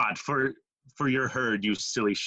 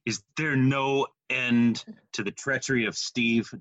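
ordinary talk

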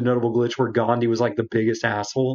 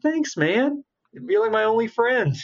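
mock grateful; 10 semitones higher, slower than usual. Self-quote, grateful to Viking.

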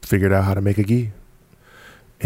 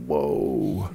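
retold awe; 5 semitones lower, 6 dB quieter than usual. Retold awe at the old-timer's explanation.

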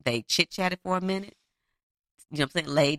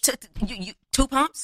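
high, stammering indignant question; acted pissed-off disbelief; 8 semitones higher, 3 dB louder than usual. Woman confronting the man over two pumps, pissed-off disbelief. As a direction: sputter in mock outrage. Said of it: "and she, pissed".

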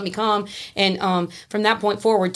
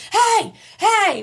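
two high calls for attention; re-enacted high yell; retold hollering; 13 semitones higher, 3 dB louder than usual. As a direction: holler it high to get noticed. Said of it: "I'm jumping".

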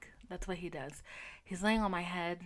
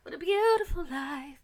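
higher, airy, different-timbre sing-song; dreamy high voice; 8 semitones higher, 5 dB louder than usual. Retold or imitated. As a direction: gush over the clean room. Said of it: "I'm like".

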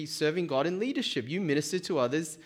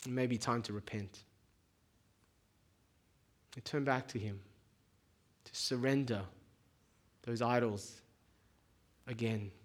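quiet, tender call to repent; soft earnest appeal; 7 dB quieter than usual. Acted. Preacher gently inviting listeners to repent, softly earnest. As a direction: pull it down, soft and sincere.

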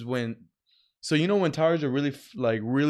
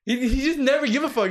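ordinary delivery

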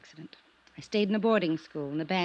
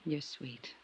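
tender whisper; 3 semitones lower, 10 dB quieter than usual. Acted. Tender moment with Johnny.

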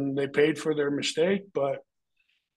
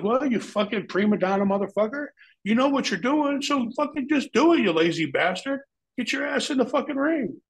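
much higher, heated mock telling-off; retold angry tirade; 11 semitones higher than usual. Wrestler retelling how he scolded Lex Luger backstage, heated and higher. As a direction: bark it at a lazy co-worker.

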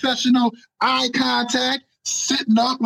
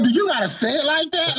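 high, exasperated mock complaint; retold exasperation; 6 semitones higher than usual. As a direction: ask "you" in disbelief, pitched up. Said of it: "I'm like".

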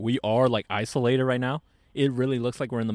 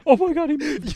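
mock startled shriek; 7 dB louder than usual. Joking about seeing Sasquatch.

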